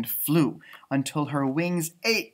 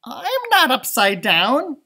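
indignant protest; 10 semitones higher, 8 dB louder, slower than usual. Acted. Stellaluna protests.

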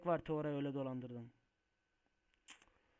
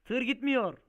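loud, high cry of dismay; alarmed shout; 9 semitones higher, 11 dB louder, slower than usual. Real, not acted. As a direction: shout that something has broken. Tır gitmeyince bağırıyor. (telaşla yüksek sesle bağırarak)

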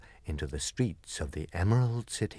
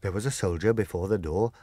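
usual speaking voice

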